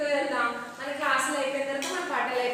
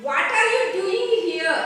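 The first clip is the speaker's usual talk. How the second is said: loud, raised, slow demand; giant's challenge; 5 semitones higher, 6 dB louder, slower than usual. Acted. Giant confronting children in his garden, loud challenge. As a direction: read it as a mildly booming challenge from the giant.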